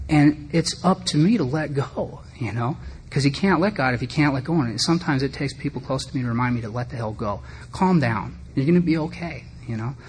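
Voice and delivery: usual speaking voice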